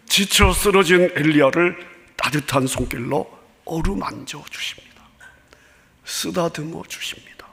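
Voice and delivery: soft tender whisper, fading away. Acted. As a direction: whisper it gently like a caress. Tender touch of God described. (부드럽게 속삭이듯)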